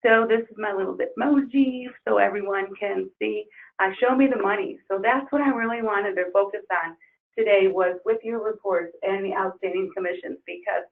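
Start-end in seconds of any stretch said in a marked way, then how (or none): none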